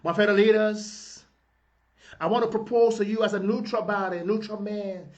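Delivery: usual speaking voice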